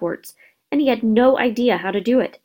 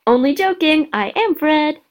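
joking twin; 7 semitones higher, 3 dB louder than usual. A narrator's reading. Twin revealing his joke.

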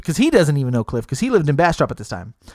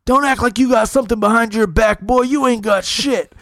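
higher, drawled hillbilly threat; 7 semitones higher than usual. Retold or imitated. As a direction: call his bluff with a sneer. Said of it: "And then Cliff goes".

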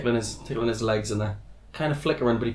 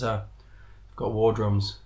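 Geordie; 6 dB quieter than usual. Acted. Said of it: "his Geordie commander".

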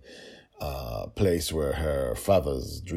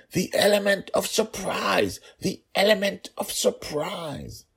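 urgent stage whisper; 4 dB louder than usual. A narrator's reading. Father warning daughter across the yard, high urgent whisper. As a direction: press the warning home, high and pleading. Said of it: "he whispered loudly".

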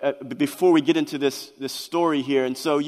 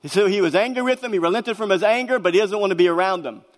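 ordinary delivery

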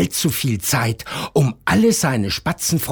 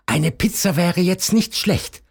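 sparrow voice; 7 semitones higher than usual. A narrator's reading. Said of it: "dachte der kleine Spatz".

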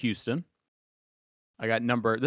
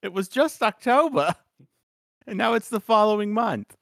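put-on high, loud old man grumble; 11 semitones higher, 6 dB louder than usual. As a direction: grumble like a cranky grandpa. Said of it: "my go-to old man voice".

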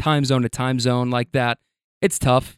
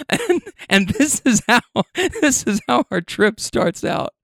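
laughing; 8 semitones higher than usual. Real, not acted. Laughing while telling.

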